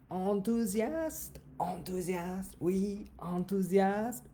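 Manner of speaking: mock enthusiastic. Shown as a demonstration. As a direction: sing the word out slowly like a thrilled kid.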